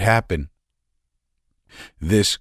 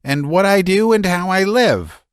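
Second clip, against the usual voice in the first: high, strained, nervous voice; a man near hysterics pouring it out; agitated Laevsky; 10 semitones higher than usual. A narrator's reading.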